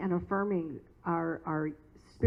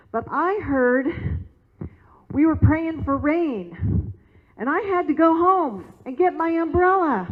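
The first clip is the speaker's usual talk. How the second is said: put-on higher, louder little girl's voice, breathless and eager; a cheerful child apologizing in a rush; young girl; 6 semitones higher, 5 dB louder than usual. Young girl apologizing to the pastor for being late, high and excited. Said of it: "and she goes".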